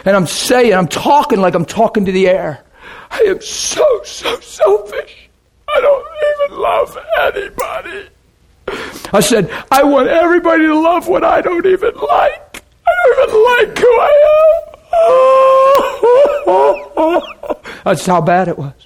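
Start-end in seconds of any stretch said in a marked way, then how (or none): 3.1-8.1 much higher, softer, breathy crying; tearful self-accusation; sobbing confession. Retold or imitated. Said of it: "crying, like". 9.7-17.6 weep out the confession; broken, tearful plea to God; retold sobbing. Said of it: "crying, like".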